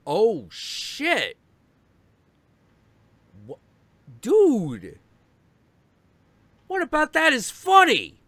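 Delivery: can't believe this is the funny story; incredulous yell. Retold or imitated. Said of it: "And I'm like".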